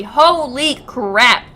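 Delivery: slow, loud, stunned disbelief; shocked exclamation. Real, not acted. Shocked by a game scene.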